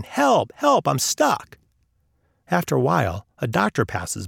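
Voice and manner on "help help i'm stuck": high-pitched mock yell, drawn out; yelling for help. A narrator's reading. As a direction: call for help, pitched well up, slowly.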